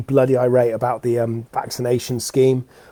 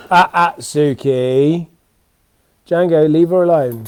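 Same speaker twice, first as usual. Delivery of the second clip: telling the dog off; 4 semitones higher, 6 dB louder, slower than usual. Real, not acted. Dog pestering the other dog.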